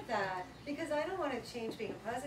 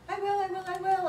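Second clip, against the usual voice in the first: put-on high, loud pleading child; whiny kid; 8 semitones higher, 8 dB louder than usual. Son dodging bedtime with his mom, high and loud. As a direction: promise eagerly like a stalling five-year-old.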